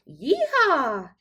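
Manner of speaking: high, loud cowgirl whoop; yelled yee-haw. Acted. The fairy godmother whoops in triumph, high and loud. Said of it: "yelled the fairy godmother".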